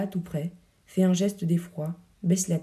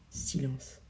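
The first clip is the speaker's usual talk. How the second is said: ordinary delivery, nothing marked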